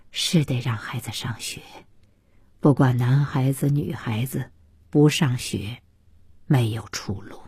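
put-on low, soft older man's voice; measured, thoughtful praise; elderly father, approving. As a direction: speak low and kindly, like an elder.

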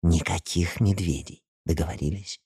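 hushed secretive half-whisper; conspiratorial whisper. Acted. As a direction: lean in and keep it between us. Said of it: "добавила заговорщицким шёпотом". (заговорщицким шёпотом)

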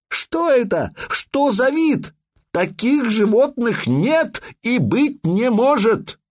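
high, slow, shocked outcry; astonished cry. Acted. Scholar crying out at the monster, high and stunned. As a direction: shriek in disbelief at the beast. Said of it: "он услышал крик Линуса Пита".

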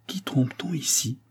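breathy whisper to himself; whispered thought. A narrator's reading. The count wondering to himself, whispered. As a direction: whisper it as a private suspicion. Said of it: "pensa-t-il".

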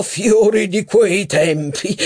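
fiori di quei tempi echoed high and slow; mock admiring echo. A narrator's reading. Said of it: "esclamò Legrandin".

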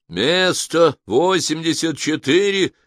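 loud, high, drawn-out machine announcement; ringing, slow automated call; booming computer. A narrator's reading. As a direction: thunder it out slowly like a computer calling numbers. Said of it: "прогремел комп".

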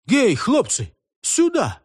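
high summoning cry; calling out. A narrator's reading. Левко summons the lads, high calling cry. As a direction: hail them with high calls. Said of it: "кричал он, махая рукою парубкам".

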